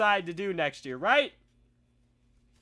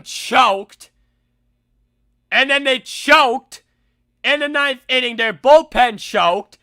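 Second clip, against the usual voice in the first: higher, louder, slow mocking rant; heated rant; 7 semitones higher, 14 dB louder than usual. Real, not acted. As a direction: hammer the chokers loud and slow. Full-force rant about playoff chokers.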